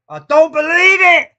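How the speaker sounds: same words now screamed, pitch way up; shouted disbelief. Real, not acted. Commentator screams at the conceded goal in disbelief. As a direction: explode with the line.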